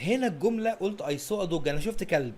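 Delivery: usual speaking voice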